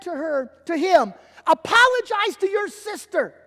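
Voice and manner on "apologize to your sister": loud, high fatherly command; stern order. Retold or imitated. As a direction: order him like a firm dad. Father orders son to apologize.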